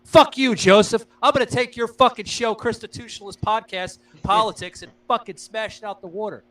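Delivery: loud, high mock taunt at a rival; hammed-up threat to steal his show; mock trash talk. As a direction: fake bravado shouted at a rival podcaster. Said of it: "not being an asshole and saying".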